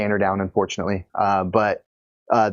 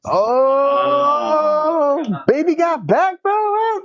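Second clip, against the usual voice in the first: high, giddy squeal into laughter; excited squeal; much slower than usual. Real, not acted. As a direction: squeal it out, then crack up. Guessing the song, squealing.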